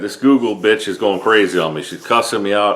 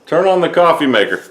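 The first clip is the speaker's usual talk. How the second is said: louder, higher repeated command; repeating to voice assistant; 5 semitones higher, 4 dB louder than usual. Real, not acted. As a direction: say it again, firm and clear for the speaker. Repeating after assistant failed.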